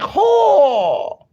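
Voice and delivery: sharp high yelp of ho; retold loud ho. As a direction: belt out a high ho. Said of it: "It was like".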